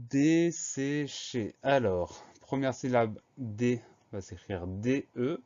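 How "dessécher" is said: slow, separated syllables for dictation. Shown as a demonstration. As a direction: sound out each syllable slowly. Teacher announces a new dictation word.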